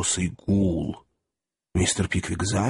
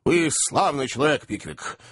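higher burst of praise, dropping at the end; hearty exclamation; 5 semitones higher than usual. A narrator's reading. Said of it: "воскликнул хозяин".

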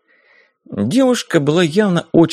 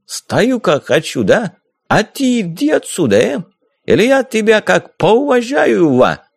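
put-on hot-tempered corsair in a thick Caucasian accent, higher; mocking Caucasian-accented pirate, raised pitch; 8 semitones higher than usual. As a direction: brush her off with a swaggering Caucasian lilt. Corsair threatens the girl.

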